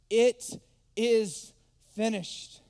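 slow, loud, drawn-out cry; Jesus's last words, loud and slow; slow loud proclamation. Acted. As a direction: proclaim it slowly at full force. Quoting Jesus on the cross.

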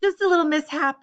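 loud, high brittle cheer; forced calm. Acted. Grace telling diners the explosion is nothing, loud. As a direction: paper over the panic loudly. Hostess covering a kitchen explosion.

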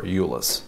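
Iulus with an initial Y sound; Latin-style pronunciation. Shown as a demonstration. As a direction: say Iulus as YOO-lus. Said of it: "depending on how they want to say it".

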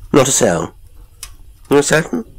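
high, insistent double-check; sharp questioning. A narrator's reading. Professor sharply questions his butler.

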